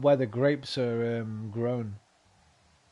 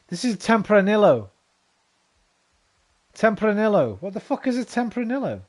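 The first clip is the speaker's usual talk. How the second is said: higher, loud "what is this" disbelief; indignant surprise, higher and louder; baffled disbelief; 6 semitones higher, 6 dB louder than usual. Real, not acted. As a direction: react like you've been handed the wrong wine. Baffled at the wrong wine.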